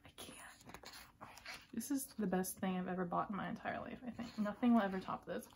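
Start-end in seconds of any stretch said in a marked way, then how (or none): 0.1-0.9 barely audible overwhelmed whisper; faint whisper. Real, not acted. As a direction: breathe it out.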